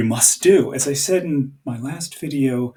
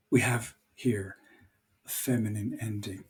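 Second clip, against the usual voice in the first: whisper; 3 semitones lower, 11 dB quieter than usual. Real, not acted. Teacher murmurs a side note.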